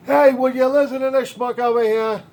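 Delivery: a brash New York accent, mocking the schmuck; New York accent, sarcastic and loud; New York accent God. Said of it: "Started talking to him in a New York accent".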